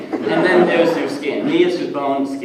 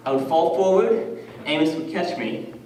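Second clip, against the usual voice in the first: normal talking voice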